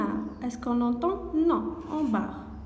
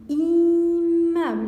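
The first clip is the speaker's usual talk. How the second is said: Immeuble said loudly and slowly, each syllable stretched; loud, slow dictation; 3 semitones higher, 6 dB louder, much slower than usual. Shown as a demonstration. Teacher to pupils, calling out Immeuble loud and slow.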